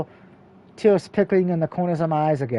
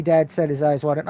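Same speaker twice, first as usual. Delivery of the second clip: usual speaking voice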